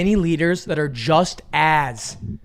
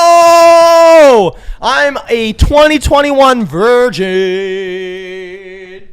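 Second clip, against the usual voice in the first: hammed-up announcer; 7 semitones higher, 10 dB louder, much slower than usual. Mock announcing own virginity.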